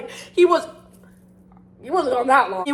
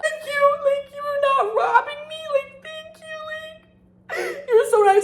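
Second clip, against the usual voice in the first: much higher, squeaky over-grateful voice; mock gushing gratitude. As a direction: gush like a lovesick girl.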